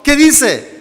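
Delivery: usual speaking voice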